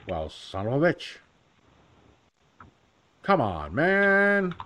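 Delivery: lightly voiced Biden, man jumping high; a cheesy folksy grumble; Biden impression. Shown as a demonstration. Vlogger demoing his easy Biden, lightly voiced. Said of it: "Joe Biden's an easy one".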